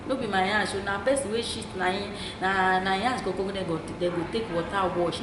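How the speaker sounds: ordinary delivery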